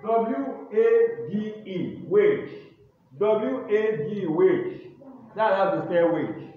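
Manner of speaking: loud, slow classroom dictation voice; spelling dictation. Acted. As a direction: call each word and letter out to the class. Teacher dictating spelling words.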